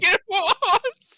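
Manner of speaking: very high, loud, drawn-out yell; stretched exasperated shriek; indignant protest. Real, not acted. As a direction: wail the complaint at the top of the voice.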